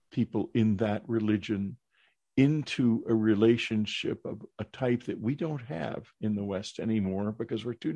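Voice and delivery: normal talking voice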